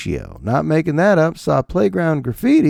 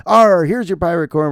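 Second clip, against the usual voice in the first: hammed-up pirate "arr", a bit higher; playful pirate; 4 semitones higher, 4 dB louder than usual. Dad serving pirate corn to his kids, mock pirate. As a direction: launch in like a goofy pirate.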